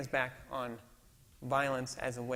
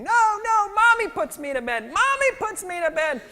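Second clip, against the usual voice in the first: much higher, louder mock child wail; screaming toddler; 13 dB louder than usual. Sleepy daughter refusing dad, high mock child screaming. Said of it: "Ruthie starts screaming".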